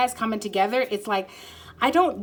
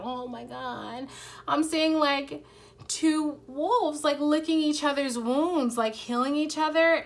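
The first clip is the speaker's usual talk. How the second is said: awed vision; 6 semitones higher, slower than usual. Real, not acted. Sudden vision during reading.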